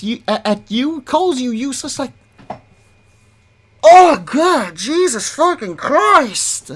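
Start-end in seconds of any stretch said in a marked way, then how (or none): 3.8-6.7 exasperated outburst. Real, not acted.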